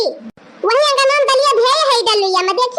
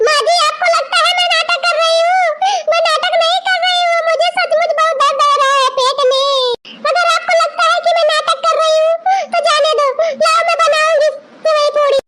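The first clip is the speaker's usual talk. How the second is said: high, tearful wail of protest; hurt protest; 7 semitones higher than usual. Acted. Daughter-in-law to mother-in-law, protesting in a high tearful wail. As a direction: play wounded innocence, close to tears. Accused of faking, wails in protest.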